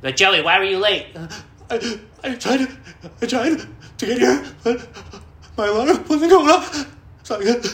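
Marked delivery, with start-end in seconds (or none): 0.2-1.1 loud, higher mock boss; mock boss demanding answers; stern boss. Boss questioning him. 1.7-6.9 high, loud whimpering excuse, slowing; mock-sobbing excuse near tears; blubbering apology to the boss; crying. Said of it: "I just start crying".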